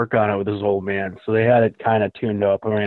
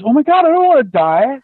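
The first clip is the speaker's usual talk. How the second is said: loud, much higher cry of panic; terrified; 13 semitones higher, 7 dB louder than usual. Retold or imitated. Retelling the brakes failing at a red light, loud high terrified voice. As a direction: blurt it as the car won't stop. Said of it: "it was".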